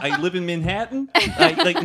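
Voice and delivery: high, laughing fake New York accent; terrible New York accent. Shown as a demonstration. Host failing at the demo, terrible New York accent. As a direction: overdo a bad Manhattan accent. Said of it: "no, that's, it's terrible".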